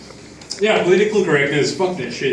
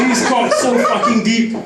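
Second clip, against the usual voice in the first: ordinary delivery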